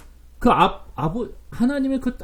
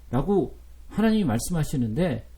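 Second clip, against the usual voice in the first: normal talking voice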